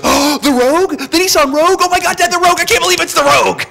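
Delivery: put-on very high, loud, gushing teen squeal; squealing teen girl. Retelling the TV cheerleader thrilled by her dad's gift, high squeal. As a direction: squeal like a thrilled teenage girl over a car. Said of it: "and she goes".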